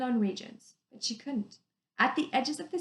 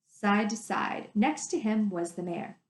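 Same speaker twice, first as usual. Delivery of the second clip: ordinary delivery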